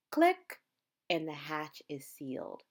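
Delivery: usual speaking voice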